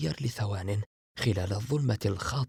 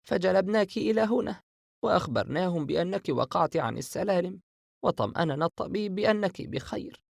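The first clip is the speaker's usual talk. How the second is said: put-on lightly raised voice of a caring older sister; Aisha's voice; 8 semitones higher than usual. A narrator's reading. Older sister telling Sara all is well, lightly voiced. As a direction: reassure her little sister gently.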